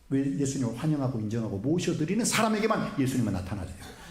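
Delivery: normal talking voice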